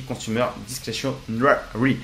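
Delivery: slow, laboured English accent on the term; French speaker carefully attempting an English accent; self-mocked English accent. Real, not acted. YouTuber reading an English sector name to viewers, slow attempted English accent. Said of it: "avec le magnifique accent anglais".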